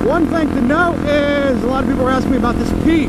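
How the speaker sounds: muffled through a helmet mic over wind; helmet mic while riding. Real, not acted. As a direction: talk from inside a helmet on the road.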